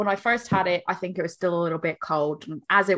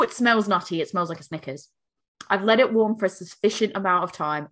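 ordinary talk, nothing marked

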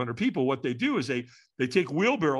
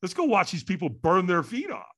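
sarcastic sure; 7 semitones higher than usual. Retold or imitated. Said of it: "And I thought".

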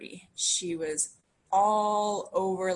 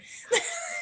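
high, breathy burst of laughter; real laugh breaking out. Real, not acted. Laughing while retelling.

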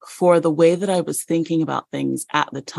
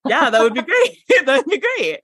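giddy excitement; 8 semitones higher than usual. Retold or imitated. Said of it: "And I was like".

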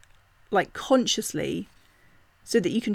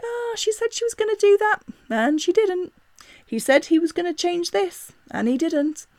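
1 voice of the body; 8 semitones higher than usual. Retold or imitated. Bodies voiced as remembering.

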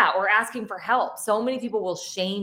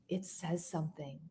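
hushed husband; 15 dB quieter than usual. Retold or imitated. Husband to wife over the little stick, hushed. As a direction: say it quietly, barely voiced. Husband reading pregnancy test in story.

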